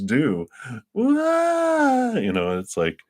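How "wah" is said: very high, hammed-up wailing cry; exaggerated mock sob-wail. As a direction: throw out a pretend mourner's wail. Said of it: "on a casket and wailing".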